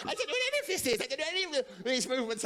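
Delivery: high, fast flustered mum voice. Retold or imitated. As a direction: deny it all in a flustered rush. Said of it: "She went".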